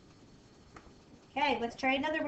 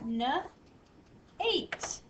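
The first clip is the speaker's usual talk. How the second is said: rising sound-out; 4 semitones higher than usual. Shown as a demonstration. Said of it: "towards the end of the word".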